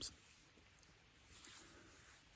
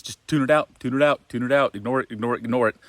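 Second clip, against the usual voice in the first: higher, louder brush-off chant; mock dismissive shouting; 4 semitones higher, 5 dB louder than usual. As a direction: drown the thought out by repeating it loudly. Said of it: "what we end up doing generally is like".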